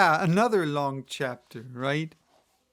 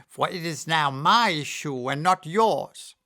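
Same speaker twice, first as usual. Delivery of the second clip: witch voice, taunting; cackling witch; 2 semitones higher, 5 dB louder than usual. A narrator's reading. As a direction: sneer it like a witch. Witch keeps the shoe.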